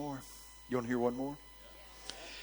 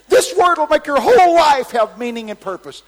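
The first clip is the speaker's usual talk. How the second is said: high, very loud proclamation; shouted prophecy; 19 dB louder than usual. Retold or imitated. Preacher stalling until the word comes, shouted prophecy. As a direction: belt out the promise to thousands.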